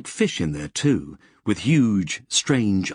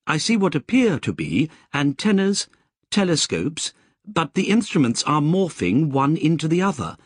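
higher, crisp machine voice from a speaker; Lobsang the AI; 7 semitones higher than usual. Acted. Lobsang reporting to Joshua from the backpack speaker, crisp and higher. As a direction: chatter it crisply like an AI over a speaker.

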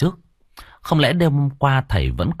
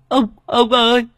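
much higher, louder whimpering call; whimpering sob; 13 semitones higher, 5 dB louder than usual. Acted. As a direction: pout and wail for grandpa. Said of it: "Thằng Tâm mếu máo".

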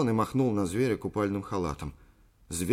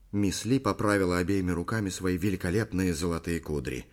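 usual speaking voice

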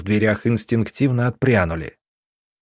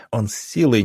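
plain reading voice

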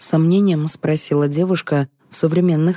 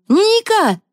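very high summoning cry; calling out; 4 dB louder than usual. A narrator's reading. Liza summoning the singer to give orders, calling out. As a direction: call the name sharply, pitched right up.

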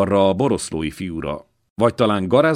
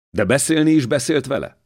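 eager question; 8 semitones higher, 5 dB louder than usual. A narrator's reading. Dénes eagerly questions the old man.

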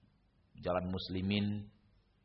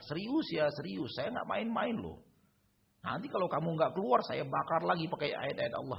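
higher pleading jinn voice, insistent; 8 semitones higher than usual. Retold or imitated. Jinn insists to the ustadz it is leaving, higher pleading voice. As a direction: beg earnestly, pitched up high.

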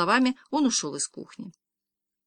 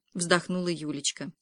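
ordinary delivery, nothing marked

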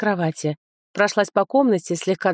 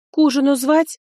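servant voice; 7 semitones higher, 4 dB louder than usual. Acted. Servant offers supper.

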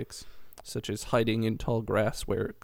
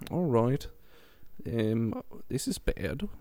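a hint of an Australian accent; lightly voiced Australian mum, worried; mock Australian mum; slower than usual. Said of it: "And mum says".

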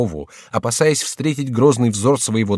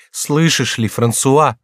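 higher, mildly urgent appeal; commanding words, pleading voice; 5 semitones higher, 4 dB louder, much slower than usual. A narrator's reading. Mother pressing her son to obey, pleading. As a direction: plead lightly under an order. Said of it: "Слова были повелительны, голос был умоляющий".

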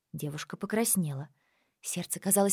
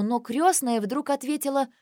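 ordinary delivery, nothing marked

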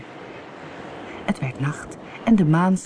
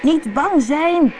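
loud, high, drawn-out call; boy's raised, stretched-out shout; 11 semitones higher, 5 dB louder, much slower than usual. A narrator's reading. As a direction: shout it slowly across the waves. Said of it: "schreeuwde hij".

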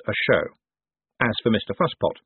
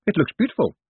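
high-pitched call, only mildly raised; shouting; 8 semitones higher than usual. A narrator's reading. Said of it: "she shouted as she reached him".

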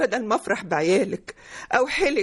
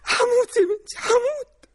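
sobbing that climbs into a high wail; distraught, tearful lament; hysterical wailing; 10 semitones higher than usual. Acted. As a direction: cry out as if it will kill you. Mother breaks down before her daughter.

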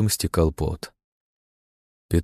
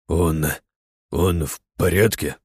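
shaky voice; 3 semitones lower, slower than usual. A narrator's reading. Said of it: "дрогнувшим голосом вопросил".

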